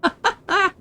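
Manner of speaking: short high burst of laughter; giggling at a silly game chat. Real, not acted. Laughing at NPC conversation.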